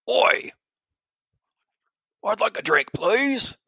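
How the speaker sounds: a bird creature mimicking a stranger's voice, high and loud; very odd voice. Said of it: "very odd voice for coming out of a bird".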